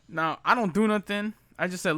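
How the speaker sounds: ordinary delivery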